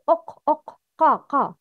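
قا heavy and full, not ka; heavy qaf. Shown as a demonstration. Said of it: "Heavy letters have heavy sounds".

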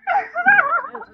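shrill giggling burst; squealing laughter; high-pitched cackle. Real, not acted.

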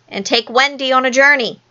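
ordinary delivery

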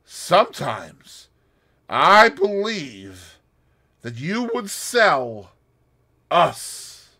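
slow, sinister and deliberate; Russian threatening her slowly; low sinister. A narrator's reading. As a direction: drag each word out with menace. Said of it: "in a low, sinister voice, he said slowly".

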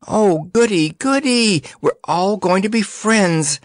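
high, bright, gleeful doll voice; excited high girl voice. Acted. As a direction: squeal with childish delight. Eartha's excited squeal.

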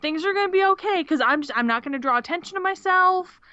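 lightly voiced timid Neville; mock Neville. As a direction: squeak it like a timid kid making a promise. Voicing Neville's resolve.

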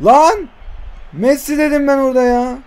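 sharp high yell of "Lan"; frustrated shout. Real, not acted. Gamer yelling at a missed chance, frustrated shout. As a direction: blurt it out at the miss.

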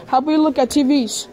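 usual speaking voice

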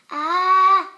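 loud drawn-out sneeze intake; big gasp before a sneeze; sneeze wind-up. Acted. Building sneeze before ah-choo.

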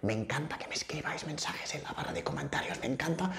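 barely voiced, secretive whisper; whispering to the viewers; whispered aside. Real, not acted. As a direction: confide it in a hushed whisper. Confiding aside about comments.

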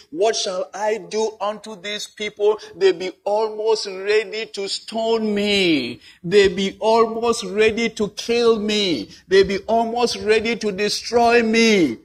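loud, pleading cry to the Lord, slowing at the start; Moses crying out. Acted. Preacher voices Moses crying out to God, loud plea. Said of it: "And Moses cried unto the Lord".

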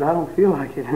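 low, soft sickly groan; sick husband refusing wife, low and soft; sick refusal. Retold or imitated. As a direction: sound too ill to care. Sick man refusing doctor.

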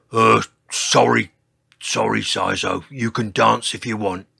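low character voice with loud bursts; grudging apology. A narrator's reading. Glared-at dinosaur apologising to the dancer, low grudging voice. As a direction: back down in a low voice. George backs down under glares.

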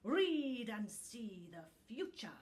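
hissed, voiceless and sinister; hissing whisper. A narrator's reading. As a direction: hiss it like a spiteful old book. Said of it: "it hissed".

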